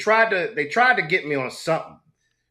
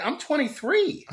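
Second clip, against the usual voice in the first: high, slow mock outrage; a much higher, drawn-out "I'm twenty-three"; incredulous; 14 semitones higher, slower than usual. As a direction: play up the disbelief at being carded. Mock offense at truancy question.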